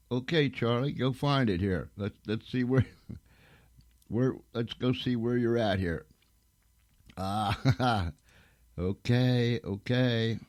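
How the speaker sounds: real, amused stalling with little laughs; amused chuckling. Real, not acted. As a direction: chuckle through an on-air hitch. Laughing as co-worker hunts for show.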